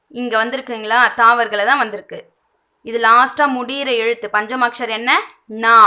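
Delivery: ordinary talk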